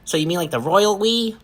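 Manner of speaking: high, swooping cartoon character voice; cartoony voice. A narrator's reading. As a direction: pipe up like an excitable cartoon kid. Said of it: "A cartoony, familiar voice occurs".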